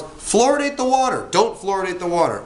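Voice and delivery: high-pitched mock demands, arguing both sides; mock public outcry. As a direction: voice two camps of squabbling protesters. Acting out opposed public demands.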